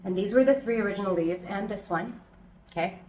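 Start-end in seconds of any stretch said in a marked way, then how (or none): none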